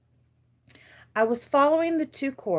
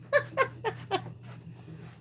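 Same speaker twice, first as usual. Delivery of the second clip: laugh; 5 dB quieter than usual. Real, not acted. Laughs at own distraction.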